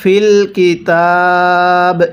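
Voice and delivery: slow, drawn-out tajweed chanting; slow Quran recitation. Shown as a demonstration. Teacher demonstrating recitation to students, slow chant. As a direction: recite each syllable long and measured. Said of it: "এরকমভাবে শিখব".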